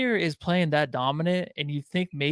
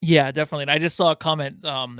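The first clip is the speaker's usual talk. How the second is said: ordinary talk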